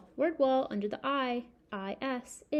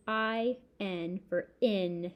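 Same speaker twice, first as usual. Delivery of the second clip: slow spelling; 2 semitones lower, much slower than usual. Shown as a demonstration. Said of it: "spelling aloud slowly".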